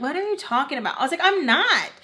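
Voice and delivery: high-pitched indignant denial; arguing. Retold or imitated. Daughter denying it to mom, indignant. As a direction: act out protesting innocence, pitch jumping up. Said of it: "So I'm, like, arguing with her".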